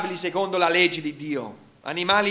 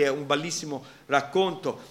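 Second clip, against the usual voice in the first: ordinary talk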